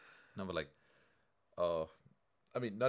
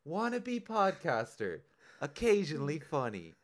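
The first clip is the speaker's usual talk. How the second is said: higher, slow, lofty reading; inspirational quote voice; 6 semitones higher, slower than usual. Pretend. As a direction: read it like a grand motto.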